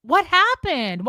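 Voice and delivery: loud, high, slow fake-anxious prying; over-the-top worried pleading; mock needy friend. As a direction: ham up a clingy friend begging to know. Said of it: "the response she wanted was".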